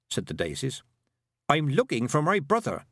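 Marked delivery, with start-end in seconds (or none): 1.5-2.9 higher-pitched, sharp statement; sharp reply. A narrator's reading. Girl telling the daisies about her brother, sharply. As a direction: say it with a sharp edge. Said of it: "said Tiffany sharply".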